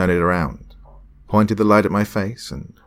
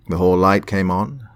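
usual speaking voice

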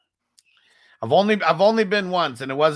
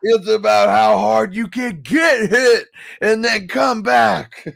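loud, slow, high Rocky line; loud slow Rocky impression; 6 semitones higher, 6 dB louder, slower than usual. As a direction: boom out the Rocky speech slowly. Rocky quote shouted slowly.

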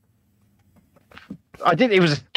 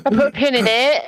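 higher put-on catchphrase voice; Ben; 5 semitones higher than usual. Co-host proves he can emulate a colleague, in a higher put-on voice. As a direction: lift the pitch and put on the catchphrase. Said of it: "I did the impression".

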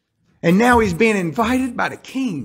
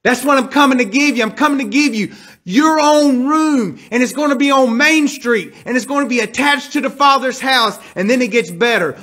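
higher and louder, driving the promise home; full-force preaching; 6 semitones higher, 4 dB louder than usual. Acted. Preacher to congregation about the promised home, fervent preaching. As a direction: preach it out with rising fire.

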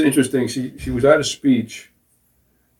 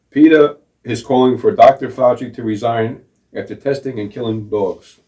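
ordinary talk, nothing marked

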